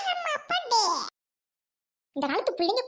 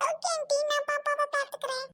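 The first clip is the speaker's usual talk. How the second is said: put-on very high child's voice; obedient child; 10 semitones higher, 3 dB quieter than usual. Child promises auntie to mind baby, obedient. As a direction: answer in a high little child's voice.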